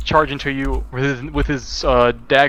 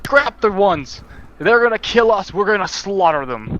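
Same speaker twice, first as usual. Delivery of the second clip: mildly raised battle cry, a bit higher; half-hearted war shout from a dwarf chief; dwarf leader rallying his ambush, a mild mock shout; dwarf leader calling out; 8 semitones higher than usual. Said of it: "He calls out".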